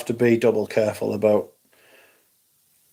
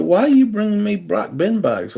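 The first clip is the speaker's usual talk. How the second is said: exasperated; 9 semitones higher than usual. Retold or imitated. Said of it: "I was like".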